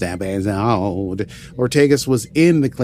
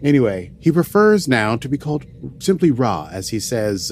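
normal talking voice

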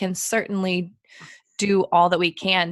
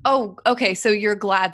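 ordinary talk, nothing marked

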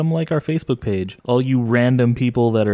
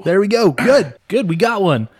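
high, loud cheer; excited; 8 semitones higher, 4 dB louder than usual. Real, not acted. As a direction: cheer it like a win. Cheering the fan theory.

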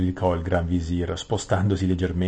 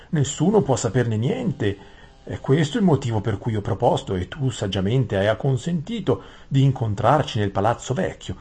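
lightly voiced, deferential vizier; a hint of courtly deference, slightly higher; Gran Visir ossequioso; 5 semitones higher, 4 dB louder than usual. (visir deferente, tono un po' più alto)

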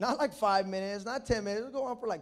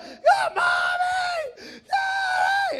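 extremely high, long scream for mommy; imitated screaming; 10 dB louder, much slower than usual. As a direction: scream like a little sister calling for help. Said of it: "she'd be screaming".

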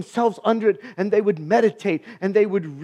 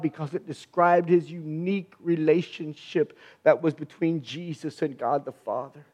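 ordinary talk, nothing marked

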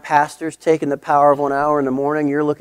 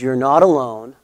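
fervent, louder pastoral encouragement; preacher voicing Paul, building to a stirring charge; Paul encouraging Timothy; 5 dB louder than usual. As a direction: lift him up like a mentor who believes in him. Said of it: "Paul ... point out with Timothy".

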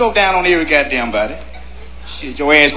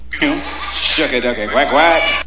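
catchphrase; 5 semitones lower, much slower than usual. Shown as a demonstration. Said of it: "One more time for you".

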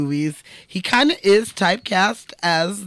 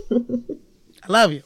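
laughing; 7 semitones higher, much slower than usual. Real, not acted. Laughing fond declaration.

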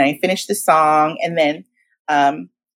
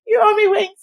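tearful tease. Retold or imitated. Said while crying to sister.

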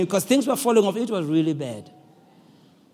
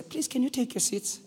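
soft, polite announcement voice; nice cabin attendant; 4 semitones lower, 12 dB quieter than usual. Acted. Cabin attendant asking passengers to sit, nice and soft. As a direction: soothe them softly and courteously. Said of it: "they speak in a nice way".

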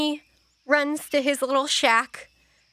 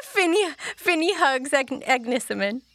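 ordinary delivery

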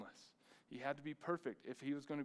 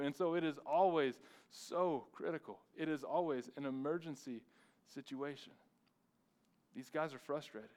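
normal talking voice

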